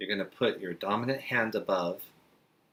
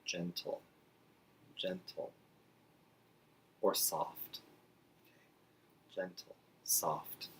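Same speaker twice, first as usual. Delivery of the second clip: gentle and soft said much softer, half whispered; soft gentle demo; 8 dB quieter, slower than usual. Shown as a demonstration. Teacher modelling gentle for parents, near whisper. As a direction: hush it like soothing a child. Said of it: "same sign as the word soft".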